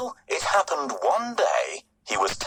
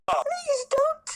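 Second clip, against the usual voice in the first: very high squeaky pleading mouse; tiny weeping mouse. A narrator's reading. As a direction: beg like a frightened mouse. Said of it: "the mouse wept".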